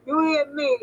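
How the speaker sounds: ordinary delivery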